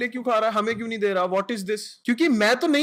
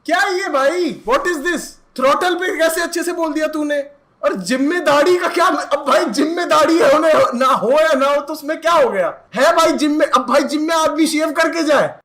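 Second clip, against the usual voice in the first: higher, much louder rant; loud outraged rant; 6 semitones higher, 8 dB louder than usual. Real, not acted. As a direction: rant hard about the fake impediment. Outraged mocking of lyrics. (नकली अटकने पर ऊँची, तेज़ आवाज़ में भड़ास)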